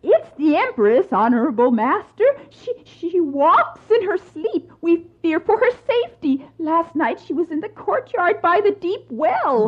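high, tearful confession in fear; frightened servant. Acted. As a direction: plead nervously to an angry master.

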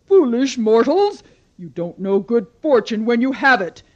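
loud commanding goddess rage; furious goddess. Acted. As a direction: thunder at the foolish mortals. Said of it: "Demeter grew so angry".

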